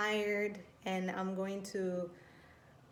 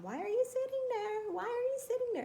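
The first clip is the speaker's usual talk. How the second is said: put-on much higher, nosy little kid asking; little sister's voice. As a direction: squeak it like a curious toddler. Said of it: "the sister would come".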